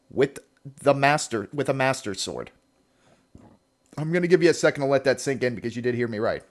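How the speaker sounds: usual speaking voice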